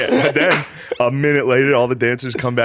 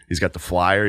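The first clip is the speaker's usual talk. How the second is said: usual speaking voice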